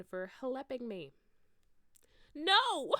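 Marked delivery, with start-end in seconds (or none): 2.3-2.9 high, loud horrified scream. Acted. Ebony screaming at the news, high and loud. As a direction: cry out in horror. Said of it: "I screamed".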